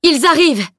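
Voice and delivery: loud, high warning cry; old master warning everyone, loud high alarm. Acted. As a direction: sound the alarm with a loud call. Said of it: "clama-t-il".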